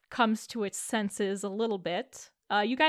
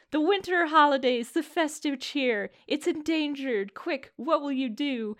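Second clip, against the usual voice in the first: dramatic adventure intro; 4 semitones higher than usual. Retold or imitated. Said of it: "I literally ran a Christmas-themed".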